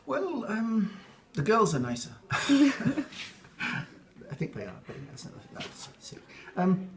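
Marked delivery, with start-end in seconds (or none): 2.2-3.3 amused laugh. Real, not acted. Laughs at own joke. 4.3-6.2 breathless whispered retraction, trailing off; embarrassed aside. Real, not acted. An interviewee drops to a sheepish whisper after joking.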